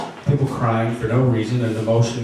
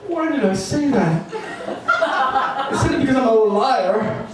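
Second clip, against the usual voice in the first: hysterical edge; 8 semitones higher than usual. Acted. Narrator confessing losses, cracking into high laughing tears. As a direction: let the grief tip into unhinged laughter. Pitch soaring, laughing through it.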